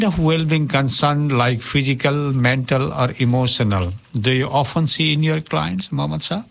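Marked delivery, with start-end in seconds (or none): none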